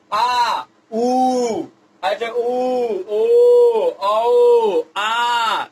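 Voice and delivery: आ ऊ ओ औ sounded out to repeat; vowel drill. Shown as a demonstration. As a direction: lead the class through each vowel. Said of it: "अभी मेरे साथ उच्चारण करिए".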